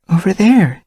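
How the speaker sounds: high, vague answer; vague young she-cat. Acted. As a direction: nod it off. Dovepaw vague reply.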